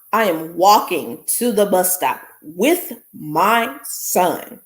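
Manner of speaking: ordinary delivery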